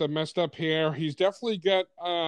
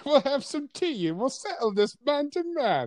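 higher, prim English voice; British accent; 7 semitones higher than usual. Pretend. British Batman proposing tea with a villain. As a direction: offer tea like a gentleman.

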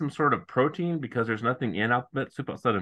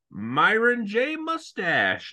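slow; 8 semitones higher, much slower than usual. Retold or imitated. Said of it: "He's like".

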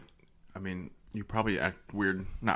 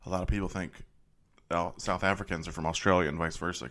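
ordinary talk, nothing marked

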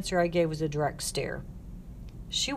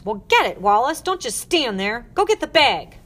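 loud, rising bark; impatient order; 5 semitones higher, 8 dB louder than usual. Acted. Matron ordering Wallace to fetch the bag, impatient. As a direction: bark at her to hurry.

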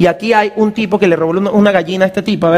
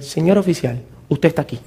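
soft explaining; 7 semitones lower, 6 dB quieter than usual. Retold or imitated.

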